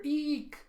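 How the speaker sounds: very high little "eek"; mock squeal. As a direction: squeak it out in pretend fright. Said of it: "and you hear".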